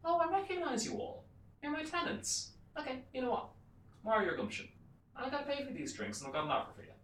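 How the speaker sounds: high-pitched put-on landlord voice; landlord addressing tenants. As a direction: play a blustering landlord, pitched well up. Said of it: "He goes".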